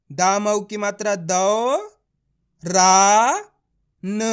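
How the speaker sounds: दौ, रा, न stretched with pauses; letter by letter. Shown as a demonstration. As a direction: spell it slowly, isolating दौ, रा and न. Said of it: "एक एक अक्षर का उच्चारण".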